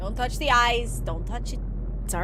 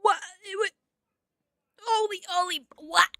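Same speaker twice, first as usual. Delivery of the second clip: ordinary talk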